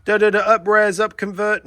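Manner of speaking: high, loud mimicry of complainers; high-pitched mocking imitation; mock bitching. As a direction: parody the know-it-all critics nagging. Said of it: "Everyone that's bitching and going".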